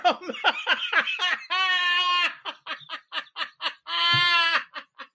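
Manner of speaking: long, high-pitched laughing fit; helpless laugh. Real, not acted. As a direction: completely lose it after your own joke. Teller collapses after punchline.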